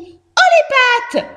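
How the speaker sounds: very high, loud mouse squeal; mouse quoting the bandit. A narrator's reading. Mouse re-enacting the hamster's order, high squeal. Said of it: "explique Josette".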